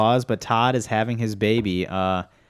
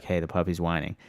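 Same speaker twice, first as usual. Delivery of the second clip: quiet nudge; 4 semitones lower, 6 dB quieter than usual. Retold or imitated. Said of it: "I'd wake her up and be like".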